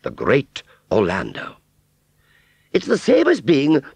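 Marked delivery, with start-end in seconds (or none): 2.7-3.8 very high, loud aunt voice; explaining grandly. Acted. Aunt Sally explaining the title to Wurzel, grandly. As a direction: explain it with haughty pride. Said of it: "she explained".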